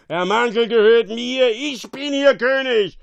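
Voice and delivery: high, loud, domineering yell; drunken ruler bellowing in fury; angry shouting. A narrator's reading. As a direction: shout your claim as king. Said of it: "schrie er".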